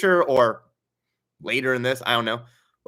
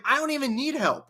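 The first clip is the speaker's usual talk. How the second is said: put-on sulky teen; 12 semitones higher than usual. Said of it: "he's just like".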